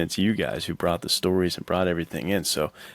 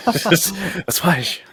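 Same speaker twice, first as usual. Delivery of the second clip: laughing; 11 semitones higher, slower than usual. Real, not acted. Joking the sword's sound.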